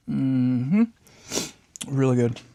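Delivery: chewing while talking; mouth full. Real, not acted. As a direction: talk through a bite. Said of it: "hear me crunching away".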